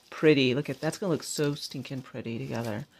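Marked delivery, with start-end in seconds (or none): none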